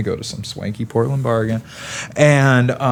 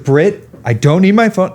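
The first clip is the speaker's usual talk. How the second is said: louder, higher protest; insistent; 6 semitones higher, 6 dB louder than usual. Retold or imitated. Arguing with friend in the Uber, louder and higher. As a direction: fire it back louder and higher.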